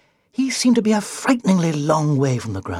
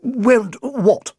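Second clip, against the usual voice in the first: higher, puzzled knight voice; nervous knight; 8 semitones higher than usual. A narrator's reading. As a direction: ask it anxiously.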